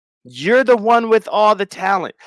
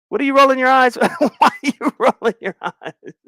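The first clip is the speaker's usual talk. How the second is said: laughing tease; 8 semitones higher than usual. Real, not acted. Teasing Penny for eye-rolling.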